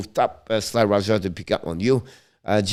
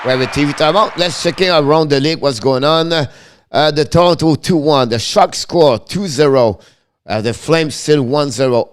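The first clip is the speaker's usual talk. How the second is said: upbeat score roundup, a bit higher and louder; 4 semitones higher, 8 dB louder than usual. Acted. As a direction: run through the other games. Around-the-league score update.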